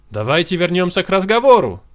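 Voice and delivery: usual speaking voice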